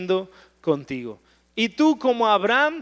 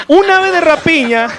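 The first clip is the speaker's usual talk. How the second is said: loud emphasis; 7 semitones higher, 11 dB louder than usual. Acted. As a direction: raise the voice, higher and louder, on the key phrase. Opens the point on the bird. (dicho en voz alta y aguda, con énfasis)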